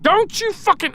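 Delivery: high, loud angry burst; angry outburst. Real, not acted. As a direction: explode at the enemy. Cursing at attacking enemy.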